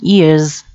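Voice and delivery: "years" ending in a Z sound; teacher's model of "years" with final -z. Shown as a demonstration. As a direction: finish "years" on a Z, as in "yearz". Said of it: "sẽ là âm Z nha các bạn".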